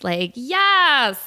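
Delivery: a hint of an eighties aerobics instructor; punchy workout yes; mock upbeat yes; eighties aerobics cheer.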